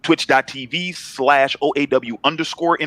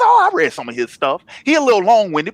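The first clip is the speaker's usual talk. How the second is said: lightly put-on higher voice; imagined reader chiming in; 6 semitones higher than usual. One reader replying to another about a writer, lightly voiced higher. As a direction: answer as another fan, pitched up a bit.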